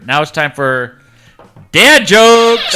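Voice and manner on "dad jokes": loud, high segment call-out; big pitched-up announcement; announcing a segment. Real, not acted. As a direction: belt out the title like a show intro. Calls out the segment title.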